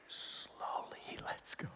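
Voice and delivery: hushed suspenseful whisper. Real, not acted. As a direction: barely breathe the words. Unvoiced whisper.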